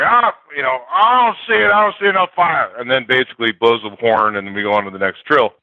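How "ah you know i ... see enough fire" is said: higher, scoffing coach voice; mock coach shrugging off a player; dismissive coach. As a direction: wave him off, unimpressed. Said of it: "he's like".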